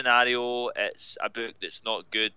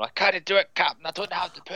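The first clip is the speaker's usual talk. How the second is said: higher, hammed-up Scottish engineer; Scotty; 6 semitones higher than usual. Scot mimics Americans doing Star Trek, hammed-up Scottish pleading. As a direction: plead to the captain in an exaggerated Scots voice. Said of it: "beam me up, Scotty".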